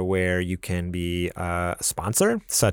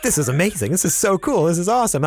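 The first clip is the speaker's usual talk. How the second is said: higher, louder thrilled burst; retold excitement; 9 semitones higher, 3 dB louder than usual. Host recalling waking to Grammy's subscription, higher and thrilled. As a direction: relive the morning thrill, pitch climbing. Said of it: "I'm like".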